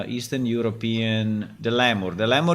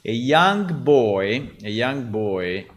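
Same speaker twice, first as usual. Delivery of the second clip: slow dictation; 3 dB louder, slower than usual. Real, not acted. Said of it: "dictating prompt slowly".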